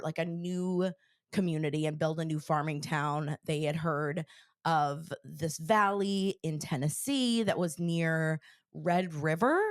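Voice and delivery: normal talking voice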